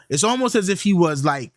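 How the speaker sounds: normal talking voice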